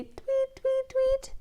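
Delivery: high, soft tiny tweets; baby bird tweet. Shown as a demonstration. Teacher to children, tweeting softly like a baby bird. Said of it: "a little tiny bird will say".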